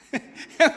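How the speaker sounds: a loud, high burst of laughter. Real, not acted. Tertawa keras di tengah khotbah. (tawa keras dan tinggi)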